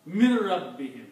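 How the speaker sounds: mir rabbihim with doubled heavy r. Shown as a demonstration. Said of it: "sound of ra should be heavy".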